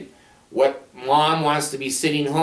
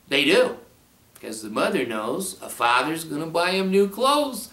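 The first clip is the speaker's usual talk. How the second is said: ordinary talk, nothing marked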